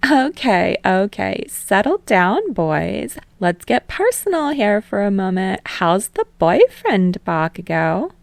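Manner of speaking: put-on playful TV host calming the boys, then prying; teasing talk show host. Host Utsushimi teasing her guests.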